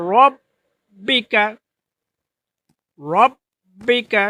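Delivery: রব্বিকা slow, with a rounded রো sound; correct Arabic pronunciation. Shown as a demonstration. Teacher recites for listeners: রব্বিকা slow, first vowel rounded. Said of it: "তাহলে কি বলতে হবে?".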